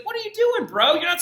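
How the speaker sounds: high, incredulous mock scolding; incredulous bro. Teacher scolding a warlike pope, mock-incredulous and high. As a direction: talk to the Pope like a buddy gone wrong. Mock exasperation at scheming popes.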